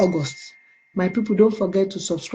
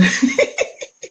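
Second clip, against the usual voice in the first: airy laugh; 4 semitones higher than usual. Real, not acted. Laughs at comment.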